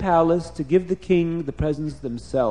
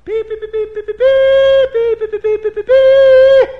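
high, loud beeping trumpet imitation; trumpet fanfare; 12 dB louder than usual. A narrator's reading. Officers sound the trumpet outside the palace, trumpet fanfare. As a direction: beep out a piercing fanfare, long last note. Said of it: "That's the trumpet sound".

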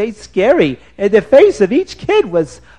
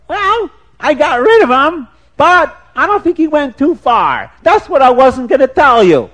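shrill woodcutter's wife; 9 semitones higher, 7 dB louder than usual. Acted. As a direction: shoo the stranger off in a high, loud voice. Woman voice in told story.

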